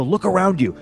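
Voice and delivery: high-pitched, emphatic command; urgent pep talk. Retold or imitated. Wrestler to a nervous newcomer backstage, urgent. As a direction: wake them up to the stakes.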